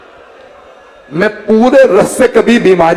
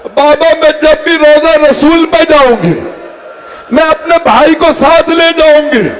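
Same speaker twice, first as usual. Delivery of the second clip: a small daughter's plea, rising higher into a sob; tearful pleading; 8 semitones higher than usual. Acted. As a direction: plead with her father through tears, the voice climbing. Little daughter pleading with her father. (बेटी की रोती हुई फ़रियाद, ऊँची होती आवाज़)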